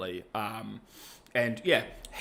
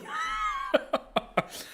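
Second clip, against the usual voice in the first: squeaky high giggle. Real, not acted. Vlogger laughing at own impression, squeaky giggle. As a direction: giggle at your own bit.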